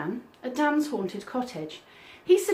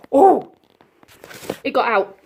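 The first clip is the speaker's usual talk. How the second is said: loud alarmed cry; raised voice; 4 semitones higher, 7 dB louder than usual. Real, not acted. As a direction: call it out in alarm. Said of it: "Sorry about the very loud scream".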